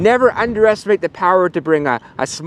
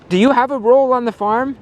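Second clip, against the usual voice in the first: higher, lightly voiced little puppy; curious puppy; 7 semitones higher than usual. Puppy asks the sheep about their role, higher and hopeful. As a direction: ask it like a hopeful pup.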